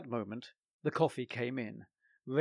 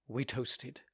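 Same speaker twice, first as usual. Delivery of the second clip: ordinary delivery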